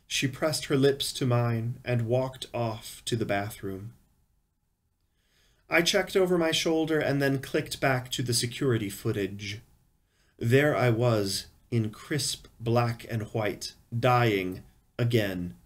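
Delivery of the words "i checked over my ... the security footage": ordinary delivery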